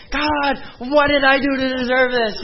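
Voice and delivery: high, loud anguished cry to God, imitated; retold crying plea. A heartbroken young man on the floor, crying out to God. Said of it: "just crying".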